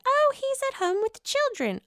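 squeaky high imitation of a guest; high falsetto quote; high mock voice. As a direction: pitch way up, mocking. Reenacted dinner exchange.

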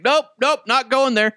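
put-on high, loud kid's squeal of refusal; kid refusing. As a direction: squeal no like a scared eight-year-old. Son refusing the trough.

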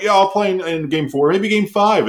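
lightly put-on player voice; imitated player's promise. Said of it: "you know, he's like".

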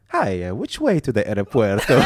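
a hammed-up Italian accent asking for the airport; mock Italian accent. Scammer asking the way to the airport, in a mock Italian accent. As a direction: play the lost Italian tourist with a put-on Italian accent. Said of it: "young Italian... typical Italian".

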